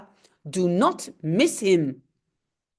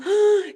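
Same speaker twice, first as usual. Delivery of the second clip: gasp; 10 semitones higher, 4 dB louder than usual. Acted. Reading Tintin comic aloud.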